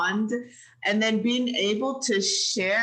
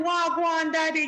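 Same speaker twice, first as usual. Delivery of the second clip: Jamaican Patois; 6 semitones higher than usual. Shown as a demonstration. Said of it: "it's in Jamaican".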